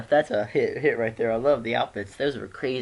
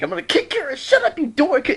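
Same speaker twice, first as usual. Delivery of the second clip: high, loud furious yell; really mad; 14 semitones higher, 9 dB louder than usual. Retold or imitated. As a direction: scream the threat, squeaky and enraged. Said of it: "He's, like, really mad".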